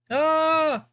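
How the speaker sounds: sudden high loud yelp of surprise; startled yelp. Real, not acted. Camera setup goes wrong, a real startled yelp. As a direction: jump at something going wrong.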